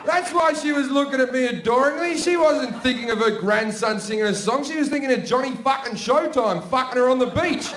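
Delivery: high, loud comic rant; scandalised grandson ranting at full voice; outraged realisation. Acted. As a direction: shout the punchline in mock horror. Outraged punchline after grandma's confession.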